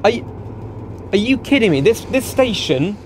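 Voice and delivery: high, stunned disbelief; are you serious; incredulous. Real, not acted. Shocked at the station.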